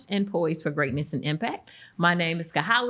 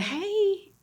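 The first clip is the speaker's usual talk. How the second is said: high, drawn-out hey; excited greeting. Retold or imitated. Recounting a phone greeting to the guest, high excited greeting. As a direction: sing out a delighted hello.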